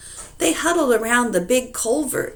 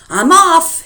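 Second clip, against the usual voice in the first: high, loud parting shout; yell; 11 semitones higher, 12 dB louder than usual. Acted. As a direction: shout it as the door slams. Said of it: "he yelled".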